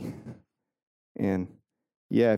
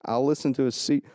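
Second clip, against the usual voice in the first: normal talking voice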